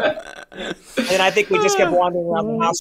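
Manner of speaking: half laughing, half tearful; telling it through happy laughter. Real, not acted. Recalling wandering the house after Mel's reply, laughing.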